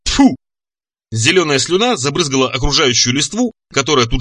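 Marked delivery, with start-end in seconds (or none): 0.0-0.3 loud, sharp spitting noise; high, explosive mock spit; forceful spit. A narrator's reading. Monster demonstrates its deadly spittle, spitting loudly. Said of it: "Чудовище откашлялось, втянуло в себя воздух".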